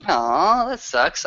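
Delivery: usual speaking voice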